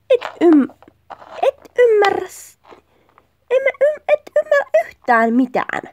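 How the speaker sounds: high-pitched, stumbling accusation; exasperated quarrel. Acted. Partner accusing the other of not understanding, exasperated. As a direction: sputter it out high, acting exasperated.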